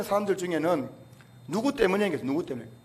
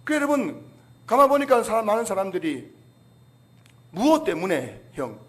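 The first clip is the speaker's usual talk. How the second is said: ordinary talk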